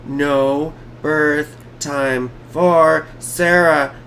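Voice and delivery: slow, separated words for emphasis. Real, not acted. Streamer stressing a missing birth time, slow and word by word. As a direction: spell out the problem one word at a time.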